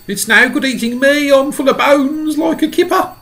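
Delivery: high, loud comic pleading; centipede voice. A narrator's reading. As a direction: plead fast to avoid being eaten. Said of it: "shouted the centipede".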